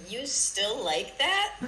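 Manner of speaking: slow, loud, incredulous echo; mock disbelief. Friend parroting a judgy dude's question, mock disbelief.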